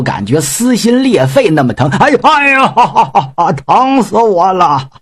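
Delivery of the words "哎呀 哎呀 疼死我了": groaning in pain. Acted. Pig demon's heart being yanked.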